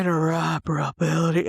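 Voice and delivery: put-on sexy voice; playful mock seduction; trying-too-hard sexy. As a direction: say it seductively, overdoing it. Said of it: "I'm trying too hard".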